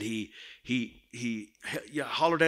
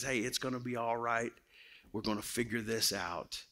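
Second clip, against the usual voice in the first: much softer, gentle comfort; soft reassurance; 8 dB quieter than usual. Retold or imitated. Preacher voicing the Holy Spirit comforting a lost child, softly. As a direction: hush them like a scared child.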